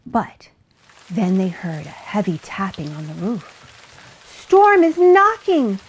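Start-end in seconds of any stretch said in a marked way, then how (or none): 4.2-5.8 high, loud, drawn-out alarm; sheep crying out. A narrator's reading. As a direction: cry it out as a frightened sheep. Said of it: "the sheep cried".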